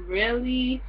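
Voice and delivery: reacting to being told she looks healthy; incredulous retort. Real, not acted. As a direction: toss it back in disbelief. Her retort to a rude compliment.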